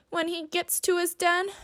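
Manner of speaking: usual speaking voice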